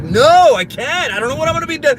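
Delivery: high-pitched exasperated snapping; short, snappy. Retold or imitated. Parent refusing son's request to play, short and exasperated. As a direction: snap back impatiently, loud on the first word. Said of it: "I was being real short with him".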